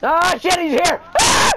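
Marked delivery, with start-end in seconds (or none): 1.2-1.5 sudden high-pitched yelp of fright; panicked high scream; startled scream. Real, not acted. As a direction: shriek as he jumps out. Startled by the enemy arriving.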